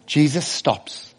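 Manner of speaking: ordinary talk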